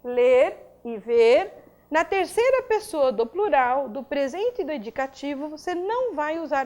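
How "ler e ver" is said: slow, loud, spelling out each verb; slow listing. Shown as a demonstration. Teacher slowly lists verbs for the students. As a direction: drag the verbs out for the class.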